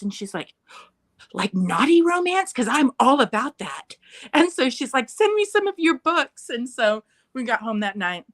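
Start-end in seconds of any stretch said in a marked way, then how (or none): 1.3-4.0 high, louder mock thrill; playfully scandalized, higher-pitched enthusiasm; delighted interest. Friend's mom delighted, acted out. 5.1-6.5 high-pitched imitation of a keen mom; excited, much higher voice; eager request. Friend's mom eagerly requests, acted out.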